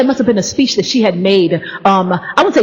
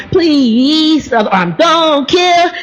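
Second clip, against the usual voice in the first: hammed-up plea; 6 semitones higher, much slower than usual. Hammed plea, arms raised.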